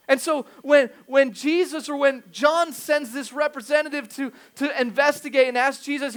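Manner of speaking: loud, high-pitched preacher delivery; forceful preaching. Acted. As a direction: drive the point home to the congregation.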